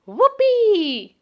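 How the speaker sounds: high, loud whoop of joy; excited cry. Acted. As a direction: let it burst out, thrilled. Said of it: "she cried".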